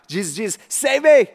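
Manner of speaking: mock panicked cry rising loud; half-hammed plea for rescue as he sinks; a sinking man's mock cry for help. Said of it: "he cried out".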